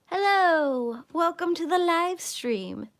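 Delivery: high, slow host greeting; announcer voice. Acted. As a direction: greet viewers like a showhost. Mock stream host intro.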